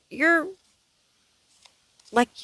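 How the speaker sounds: ordinary delivery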